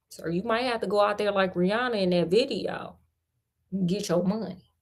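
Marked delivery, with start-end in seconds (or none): none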